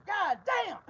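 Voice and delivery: sudden high yell of disbelief; shocked shout. Acted. Cyrus shouting at his new arms, stunned loud yell.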